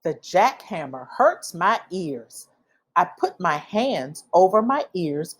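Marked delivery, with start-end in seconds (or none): none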